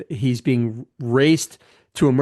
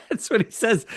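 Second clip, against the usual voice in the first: laughing; 8 semitones higher than usual. Real, not acted. Laughing at the retold line.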